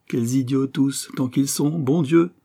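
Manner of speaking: exasperated. Acted. Poet railing at the critics, exasperated. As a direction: let the exasperation climb to the oath.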